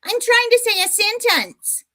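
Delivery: high mocking squeak of his words; mocking whiny imitation. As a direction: mimic him like a whiny child. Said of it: "Listen at this little dweeb".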